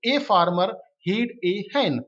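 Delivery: drawn-out word by word reading; slow dictation reading. Shown as a demonstration. Teacher dictating the example sentence slowly. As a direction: read it slowly for the class. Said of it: "teacher rereads the example".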